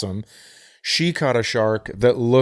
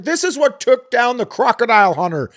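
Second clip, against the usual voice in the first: much higher, louder astonished build; excited; 11 semitones higher, 4 dB louder than usual. Real, not acted. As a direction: marvel at the monster fish. Excited description of huge ray.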